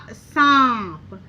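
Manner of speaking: सांप drawn out with a nasal vowel; nasal pronunciation. Shown as a demonstration. Teacher showing what we really say, सांप long and nasal. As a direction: stretch सांप slowly, vowel ringing through the nose. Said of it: "थोड़ा सा नाक से उसकी आवाज़ निकली".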